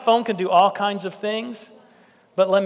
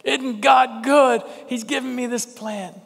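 a touch of a thrilled Jonah marvelling, higher; delighted Jonah; 4 semitones higher than usual. Retold or imitated. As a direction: savour it, mock-amazed at the gift. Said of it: "and he's thinking".

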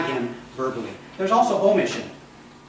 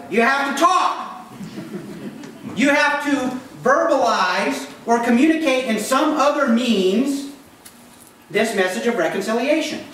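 higher, louder, pressing the point home; urgent preaching; 8 semitones higher, 4 dB louder than usual. Real, not acted. Preacher urging the congregation to talk, loud and high. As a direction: drive it home to the flock.

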